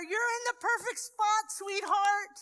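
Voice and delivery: a pastor's tender urging; tender encouragement. Retold or imitated. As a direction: lift her up gently but firmly. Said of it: "And I'm telling her".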